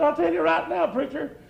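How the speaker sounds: put-on old hunter answering the preacher's teasing. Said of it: "He said".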